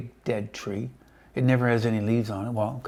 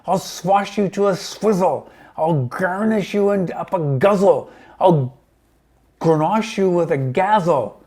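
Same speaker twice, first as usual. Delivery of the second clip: enraged hag shouting down; raging shout; 7 semitones higher, 10 dB louder than usual. A narrator's reading. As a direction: hammer each threat out in rage. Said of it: "she shouted".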